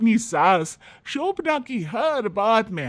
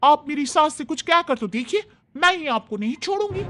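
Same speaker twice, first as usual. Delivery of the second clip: put-on higher, bright-eyed young bride defending her mother-in-law; young daughter-in-law; 9 semitones higher than usual. Young bride tells off the neighbour Lata, higher and defiant. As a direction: stand up loyally for your mother-in-law, voice higher.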